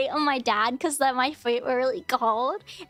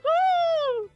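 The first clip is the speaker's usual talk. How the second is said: high, long "woo" imitating dad; cartoonish yelp; 3 dB louder than usual. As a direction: yelp like a cartoon cat shocked by cold. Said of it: "he was doing that".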